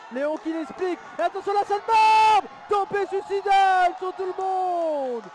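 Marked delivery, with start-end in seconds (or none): 1.2-5.2 excited shout. Acted. Live wrestling play-by-play climax.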